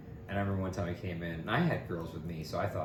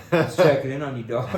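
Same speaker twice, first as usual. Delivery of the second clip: trying to be cool; 4 semitones higher, 11 dB louder than usual. Retold or imitated. Trying to look cool for girls.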